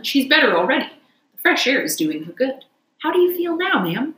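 a hint of a fussing gentleman; kindly gentleman.

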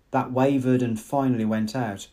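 usual speaking voice

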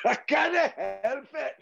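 put-on high-pitched comic Scottish accent; playful put-on Scottish brogue. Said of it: "I keep doing the Scottish".

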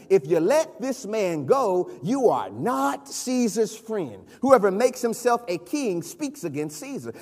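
usual speaking voice

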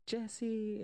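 high-pitched pleading call; imitated girl calling. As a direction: call out the name like a worried girlfriend. Retold girl calling out to Jesse.

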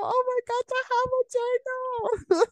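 real high-pitched giddy squeal; dorky excitement. Real, not acted. Podcaster geeking out to listeners about their own journal, giddy squeal. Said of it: "I'm being a dork".

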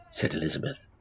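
ordinary delivery

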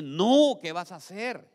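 loud, high "no", easing off after; retold alarmed rebuke. The Lord stopping King Abimelech, in a retold loud rebuke.

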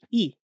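e said with the mouth nearly closed; a short closed "e"; closed vowel e. Shown as a demonstration. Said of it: "or it's closed, like".

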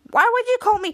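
loud, high, startled ask; alarmed question. Retold or imitated. Henry answering the teacher, loud high alarm. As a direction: yelp it out caught off guard. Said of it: "And Henry's like".

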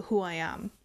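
usual speaking voice

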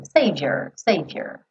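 savior with a lazy schwa, "sevior"; "savior" with a dull a sound; schwa ending. Shown as a demonstration. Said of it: "som bobo do a".

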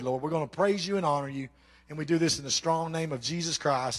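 prayer. Acted.

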